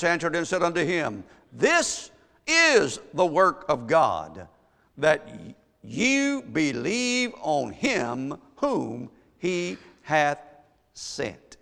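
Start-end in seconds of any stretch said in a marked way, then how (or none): 1.5-11.6 very slow, weighted word-by-word proclamation; preached scripture reading. Acted. Preacher reading Jesus' answer to the church, slow emphatic preached reading. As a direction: set each word apart with pauses.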